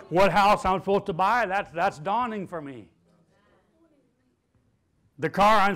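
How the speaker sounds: loud, building declaration; rising preacher's chant; preached declaration. Shown as a demonstration. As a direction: proclaim it to the crowd. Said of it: "Everybody say".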